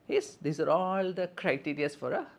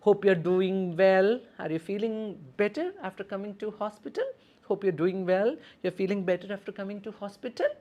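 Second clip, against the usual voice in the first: caring nurse check-in; 6 semitones higher, 3 dB louder than usual. Shown as a demonstration. Nurse asks about patient.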